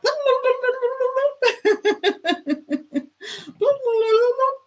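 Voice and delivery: high, long cackling laugh; long laughing fit. Real, not acted. Streamer laughs hard at a viewer's chat comment, high and long.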